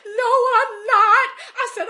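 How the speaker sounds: imitating a loud, high wail; bursting out crying. Shopper answering kind stranger, bursting into a loud wail. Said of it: "I bust out crying".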